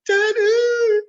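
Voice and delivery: put-on high, loud wailing child's voice; imitating crying daughter. Dad retells his crying daughter, high loud wail. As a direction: sob it out like a little girl.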